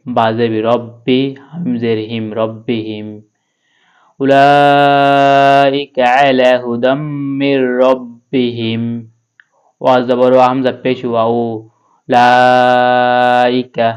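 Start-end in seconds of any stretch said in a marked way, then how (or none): 4.2-8.8 slow chanted recitation with long held vowels; Quran recitation. Acted. Teacher reciting the finished phrase for students, slow chant. 12.1-13.9 Laika with the লা held very long; long vowel. Shown as a demonstration. Teacher demonstrating the stretched vowel to students. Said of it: "এখানে হচ্ছে টেনে পড়তে হবে".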